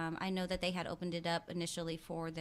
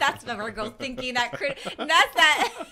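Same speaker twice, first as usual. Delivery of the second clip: loud, high laughing tease; 7 semitones higher, 13 dB louder than usual. Real, not acted. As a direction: talk through a big laugh. Teasing remark amid laughter.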